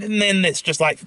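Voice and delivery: ordinary talk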